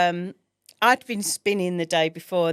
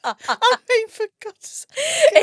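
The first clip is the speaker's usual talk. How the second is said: high, slow, laughing in embarrassment; laughing exasperation; 6 dB louder, much slower than usual. Real, not acted. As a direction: cringe at yourself through laughter.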